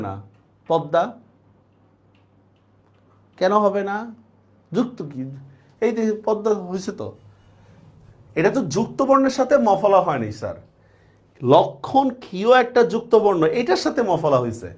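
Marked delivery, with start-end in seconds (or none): none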